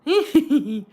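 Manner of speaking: very high, loud comic squeal; mock helpless squeal. Imitating herself laughing in the corner, squealed. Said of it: "laughing so hard with her legs crossed going".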